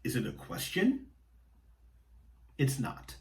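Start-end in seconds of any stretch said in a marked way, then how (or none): 0.0-1.1 "question" lifted upward at the very end; rising question tune. Shown as a demonstration. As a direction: ask it with a clear upward lift on "question". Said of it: "normally our pitch goes up at the end".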